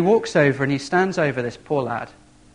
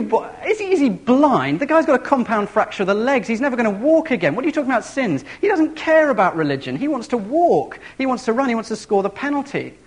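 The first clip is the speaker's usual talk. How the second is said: incredulous outburst; 8 semitones higher than usual. Acted. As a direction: play the baffled fan who can't believe it. Preacher mocks crowd's disbelief.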